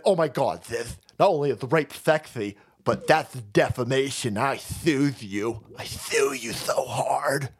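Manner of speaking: slow, hammed-up accuser turning whispery; mock her, slowing into a breathy hiss; mocking E. Jean Carroll. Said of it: "And she said".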